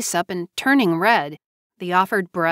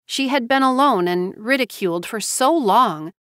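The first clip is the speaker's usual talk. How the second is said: plain reading voice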